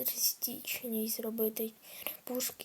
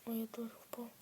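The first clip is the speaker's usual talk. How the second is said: whispering; 14 dB quieter than usual. Real, not acted. Fell in game, whispers.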